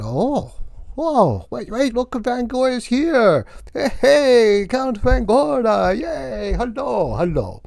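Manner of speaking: high excited hey hey hello; excited greeting. Retold or imitated. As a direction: gush at a friend who just arrived, voice leaping up. Greeting friend excitedly, voice jumps up.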